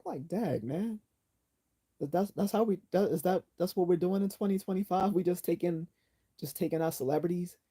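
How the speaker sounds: high-pitched, disbelieving protest; incredulous dismay. Real, not acted. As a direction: question it in a high, exasperated voice. Protests losing two celebrities back to back.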